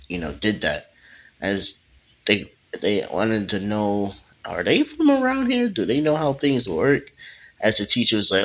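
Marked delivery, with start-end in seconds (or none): 4.4-7.1 surprised classmates. Retold or imitated. Classmates question new students.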